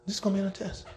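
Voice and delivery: hushed emphatic repeat. Shown as a demonstration. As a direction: let it sink in, hushed. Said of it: "It's worth repeating".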